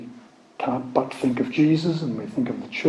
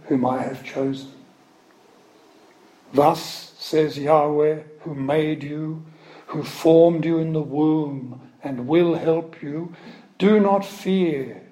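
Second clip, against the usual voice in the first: slow, raised, solemn proclaiming; slow solemn scripture reading; 4 semitones higher, slower than usual. Acted. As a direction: read it slowly like a prophet calling Jacob.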